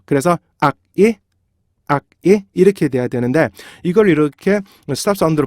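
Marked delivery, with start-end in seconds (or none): 0.6-2.4 "악, 이" split by a K stop; 윽으로 끊은 이중모음. Shown as a demonstration. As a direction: stop hard on "악", then say "이" separately. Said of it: "중간에 윽이 들어가야 돼요". ("악, 이"를 K로 끊어서)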